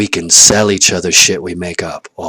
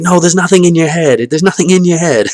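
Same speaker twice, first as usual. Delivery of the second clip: loud, high mock retort; exasperated retort; 10 semitones higher, 8 dB louder than usual. Answering someone who says in my head, loud and high. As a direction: shout it back like a fed-up know-it-all. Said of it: "somebody says, "In my head".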